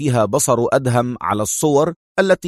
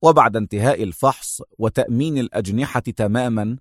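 ordinary delivery

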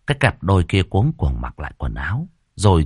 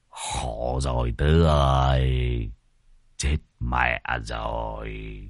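much lower, slow, soft groaning dismay; despairing old men; 8 semitones lower, 4 dB quieter, slower than usual. Acted. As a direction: moan that the trouble just doubled.

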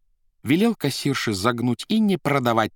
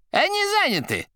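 high, rattling, far higher voice; shaky old voice; 3 dB louder than usual. A narrator's reading. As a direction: rattle it out in a shrill old quaver. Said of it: "ответила трубка дребезжащим голосом".

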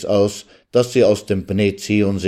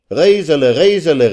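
loud high Reisele, Reisele falling each time; calling out; 8 semitones higher, 6 dB louder than usual. A narrator's reading. The guests shout the bride's name back at the lawyer, loud. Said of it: "rief man ihm entgegen".

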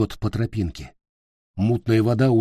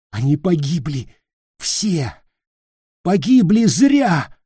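frantic shouting; 6 semitones higher, 4 dB louder than usual. Acted. Peter's eyes burn with madness.

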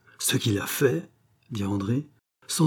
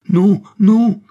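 much higher and louder, terrified; panicked cry; 11 semitones higher, 11 dB louder than usual. A narrator's reading. The distraught countess crying out against Gilbert, terrified. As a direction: scream out in terror. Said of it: "s'écria la comtesse éperdue".

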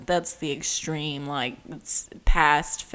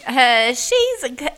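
high, loud mock accusation; put-on voice; 8 semitones higher, 7 dB louder than usual. Podcaster jokingly calling out co-host, silly put-on voice. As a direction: call it out in a silly raised voice. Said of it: "Why are you talking in that voice?".

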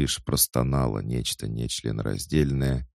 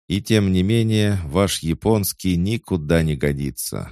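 raised, firm voice of a tsar; stern tsar voice; 7 semitones higher, 6 dB louder than usual. A narrator's reading. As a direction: lecture him like a strict king. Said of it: "ответил царь".

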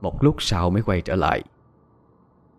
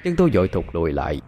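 plain reading voice

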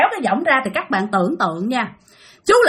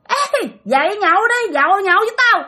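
high-pitched rousing holler; ghost calling out; 7 semitones higher than usual. Retold or imitated. Ghost waking dad up to drink, high eerie call. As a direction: summon a sleeper from beside the hammock. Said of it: "nghe tiếng kêu mình ra nhậu".

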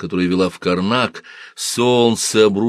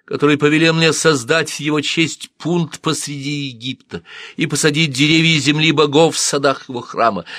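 solemn proclamation; 7 semitones higher, 4 dB louder than usual. Acted. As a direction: proclaim it to the god in the temple. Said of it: "чтобы обратиться к Богу во всеуслышание".

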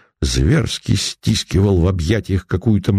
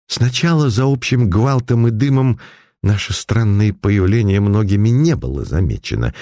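usual speaking voice

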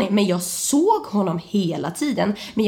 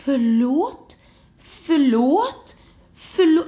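halting sorry, pitch climbing with each; scared apology; 3 semitones higher, much slower than usual. Retold or imitated. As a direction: plead forgiveness, pausing between each word. Acted frightened apology.

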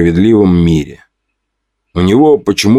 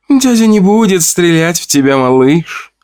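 tender; 10 semitones higher than usual. A narrator's reading. Lola gently calming the chimera. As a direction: soothe the monster like a child. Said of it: "ласково сказала монстру".

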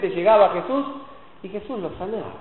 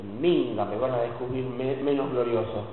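secretive mutter; 7 semitones lower, 5 dB quieter than usual. Retold or imitated.

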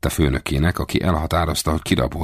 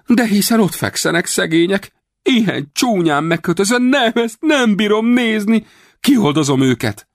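feigned shock; 4 dB louder than usual. A narrator's reading. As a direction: overact the horror. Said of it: "meglepetten felkiáltott". (színlelt szánakozó felkiáltás)

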